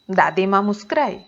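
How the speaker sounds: ordinary delivery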